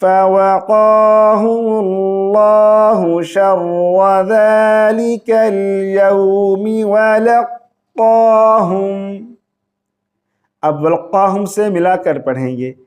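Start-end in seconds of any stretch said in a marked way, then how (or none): 0.0-8.9 slow melodic tilawat with long held vowels; Quran recitation. Shown as a demonstration. Teacher reciting the verse for students, melodic recitation.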